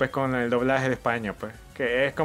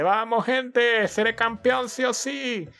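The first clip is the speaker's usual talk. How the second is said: high, drawn-out rallying cry; excited cheering; 8 semitones higher, slower than usual. Real, not acted. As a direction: pump up the team, slow and high. Animando a su Pokémon. (ánimo eufórico y agudo)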